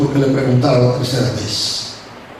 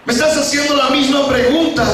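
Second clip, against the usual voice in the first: high-pitched, anguished complaint, long drawn phrases; acted lament, climbing in pitch; tearful pleading; 9 semitones higher than usual. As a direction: plead it out, hurt and building higher. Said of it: "comenzó a llorar y dice".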